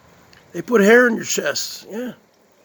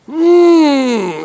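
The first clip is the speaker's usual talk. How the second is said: a higher, louder hammed-up yummy moan; milk the bite for the camera; high loud mock moan; 8 semitones higher, 8 dB louder than usual. Streamer savoring a slice on camera, high mock moan. Exaggerated eating moans.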